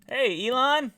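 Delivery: loud, high call across the room. Real, not acted. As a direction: call out the greeting. Calling out to character.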